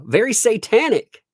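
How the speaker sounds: high, loud dramatic accusation; hammed-up horror for the judge; mock outrage. Telling Your Honor about cultist video, mock outrage.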